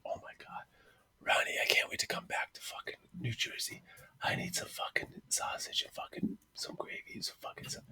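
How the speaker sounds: hammed-up, breathless craving in a whisper; sensual whisper. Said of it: "it was all sensual like that".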